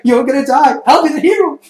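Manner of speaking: high, loud gleeful boast; happy deranged hero. Retold or imitated. Assassin gleefully fighting, high loud hero boast. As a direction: crow it with mad glee. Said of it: "He's, like, happy".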